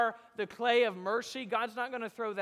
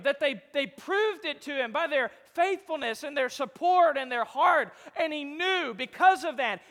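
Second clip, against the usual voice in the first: loud, fervent sermon climax; preaching at full force; 4 semitones higher, 7 dB louder than usual. Acted. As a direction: drive it home at full force.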